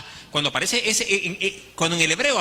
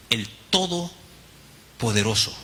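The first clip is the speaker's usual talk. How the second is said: el Todopoderoso stretched very slowly and lower; solemn emphasis; 7 semitones lower, much slower than usual. Shown as a demonstration. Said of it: "en esa expresión".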